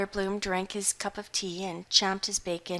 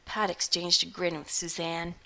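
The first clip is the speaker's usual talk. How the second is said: ordinary delivery, nothing marked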